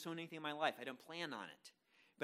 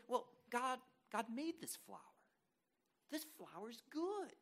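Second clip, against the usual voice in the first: understated approval; 5 semitones higher, 4 dB quieter than usual. Acted. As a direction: approve of it without much excitement. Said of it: "I don't get as excited about it".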